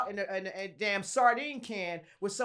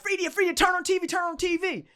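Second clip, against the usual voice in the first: high-pitched urgent call; imitating excited relatives calling; 9 semitones higher than usual. Family calling her urgently to TV.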